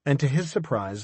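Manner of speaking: plain reading voice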